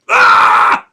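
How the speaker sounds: sudden full-force "Ah!"; imitated scream. Ghost voice screaming right in his ear, imitated at full force. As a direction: blast out a very loud, harsh shriek. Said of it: "someone's just screamed, proper screamed down my ear".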